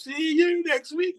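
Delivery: very slow, high sing-song sign-off; mock sitcom sign-off, high and slow; catchphrase impression. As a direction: draw it out high and slow like a TV closer. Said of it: "your music goes".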